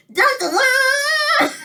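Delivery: very high, slow sung beeps; bird imitating toy. The bird copying the toy, very high slow sung beeps. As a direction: sing it like a parrot copying a toy. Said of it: "The bird learned to go".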